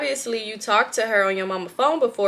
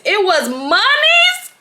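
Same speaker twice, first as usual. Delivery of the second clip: drawn-out, much higher and louder, slow; stunned reveal; shocked; 11 semitones higher, 7 dB louder, much slower than usual. Real, not acted. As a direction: gasp out the twist in disbelief. Shock at the twist.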